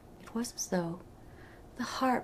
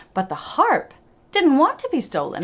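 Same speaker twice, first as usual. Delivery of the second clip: ordinary delivery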